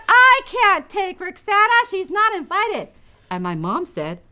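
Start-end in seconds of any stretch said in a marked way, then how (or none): 0.1-2.9 annoyed big sister. Acted.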